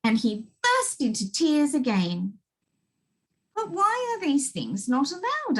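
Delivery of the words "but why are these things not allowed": innocent question. Acted. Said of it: "asked little Miss Sunshine".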